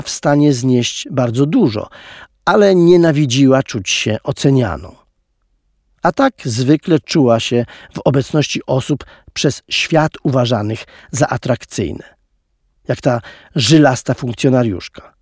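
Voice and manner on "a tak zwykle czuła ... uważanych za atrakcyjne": plain reading voice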